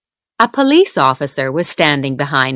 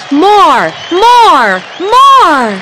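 loud chant rising higher each time; crowd shouting; 11 semitones higher, 7 dB louder, much slower than usual. A narrator's reading. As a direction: shout More! like a crowd begging for an encore. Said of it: "They were screaming and shouting".

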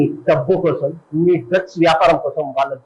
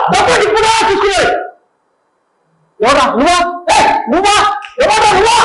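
screaming, much higher and louder; furious shout; 4 dB louder than usual. Acted. Auditioner picks a fight, screaming who dares. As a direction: roar a challenge at the crowd. Drunken-style challenge audition.

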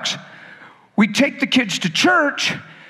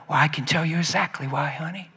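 low, soft explanation to honey; tender parent; 5 semitones lower, 6 dB quieter than usual. Acted. Parent softly explaining to daughter, tender and low. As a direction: lean in gently to your child.

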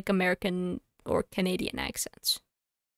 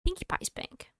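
whisper; 8 semitones higher, 8 dB quieter than usual. Real, not acted. Repeats it under breath.

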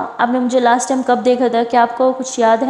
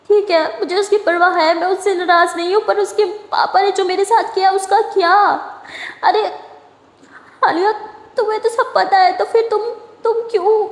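tearful; 7 semitones higher than usual. Retold or imitated. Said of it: "माया रोते हुए आलिया को हग कर लेती है".

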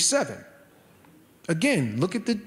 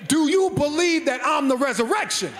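said again, louder for the cheap seats; louder repeat; 5 semitones higher than usual. Retold or imitated. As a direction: push it out to the back rows. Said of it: "say it one more time for the people in the cheap seats".

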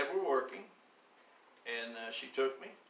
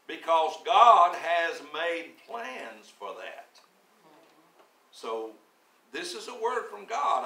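louder, slow, building sermon; preaching; 3 semitones higher, 7 dB louder than usual. Acted. Pastor proclaiming God's plans to the congregation, louder. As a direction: preach it out with conviction.

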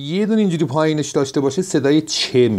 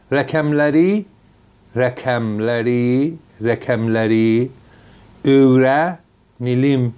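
1 slow model reading; 2 semitones lower, slower than usual. Shown as a demonstration. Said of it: "teacher models slow repetition".

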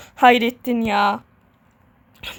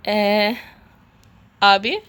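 usual speaking voice

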